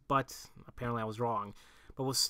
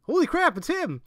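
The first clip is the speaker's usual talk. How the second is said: high, loud shocked exclamation; holy crap moment; excited outburst; 8 dB louder than usual. Real, not acted. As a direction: blurt it out in real surprise. Reacting to a surprise in game.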